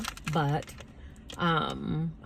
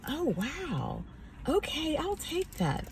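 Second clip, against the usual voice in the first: put-on soft, high, awed old-lady voice; sweet old lady; 10 semitones higher, 6 dB quieter than usual. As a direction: imitate an old lady quietly amazed. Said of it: "little sweet old lady".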